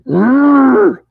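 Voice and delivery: loud, high imitated beast growl; hammed-up monster roar; Sasquatch growl. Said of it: "he goes".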